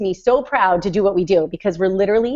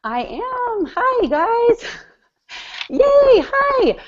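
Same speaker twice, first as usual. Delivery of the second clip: bubbly high-pitched hello to the group, much higher; excited; much slower than usual. Real, not acted. Joining a team call fresh from an event, excited greeting. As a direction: squeal out a thrilled greeting. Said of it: "can you tell I'm excited?".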